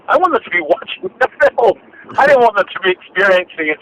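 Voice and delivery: high, fast, laughing disbelief; a mock-incredulous protest, quick and high; exasperated retort. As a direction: push back half-laughing at the idea. Retelling his objection to producers.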